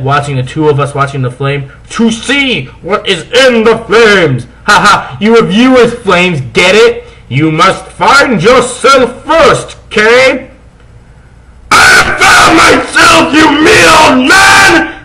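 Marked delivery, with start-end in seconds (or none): 1.9-10.5 high, slow hammed-up wizard; old wizard voice. Dumbledore lecturing the two goths, high and hammy. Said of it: "Dumbledore said". 11.7-14.9 high, loud furious shout; angry yell. Acted. Goth boy yelling at the headmaster, furious. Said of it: "Hargrid yelled".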